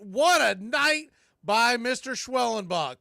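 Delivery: normal talking voice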